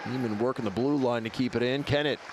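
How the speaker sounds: live hockey play-by-play. Acted. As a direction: call the action live. Play-by-play of game.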